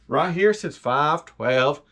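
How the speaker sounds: slow, lazy country delivery; slow country drawl. Shown as a demonstration. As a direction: stretch the date out slow, country style. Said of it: "You gotta say it like that".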